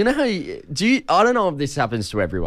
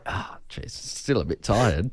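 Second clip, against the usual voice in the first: softer, slower, half-whispered drowsy mutter; sleepy mumble; 6 semitones lower, 6 dB quieter, slower than usual. Retold or imitated. Dozing on the tram, muttering to himself half-whispered. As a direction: mumble like you're nodding off again.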